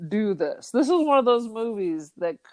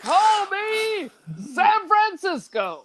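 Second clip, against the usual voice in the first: mock grand voice; 11 semitones higher, slower than usual. Jokey self-nickname bit.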